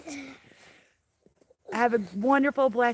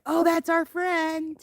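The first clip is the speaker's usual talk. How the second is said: sweet child imitation; 5 semitones higher, slower than usual. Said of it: "And he's like".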